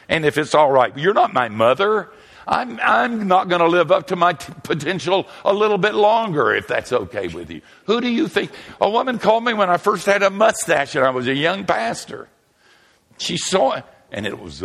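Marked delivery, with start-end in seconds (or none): 0.0-8.5 high, loud mocking scold; mocking sanctimonious nag; self-righteous scold. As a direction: ham up a preachy busybody wagging a finger. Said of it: "self-righteous people".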